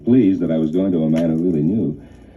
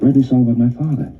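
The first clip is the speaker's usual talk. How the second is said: louder, faster, insistent; emphatic repeat; 5 semitones higher, 4 dB louder, faster than usual. Retold or imitated. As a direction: say it again, firmly.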